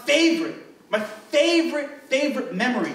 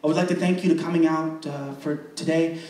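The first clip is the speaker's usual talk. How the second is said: a light, lower mock pastor voice, welcoming everyone; pastor addressing the congregation; 7 semitones lower than usual. Pastor welcoming the congregation to a baptism, in a lightly put-on formal voice.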